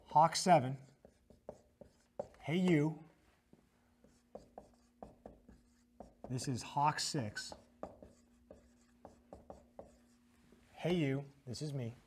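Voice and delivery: clipped radio call, spaced out; radio operator call. Shown as a demonstration. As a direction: call it like on the net. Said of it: "So he's gonna say".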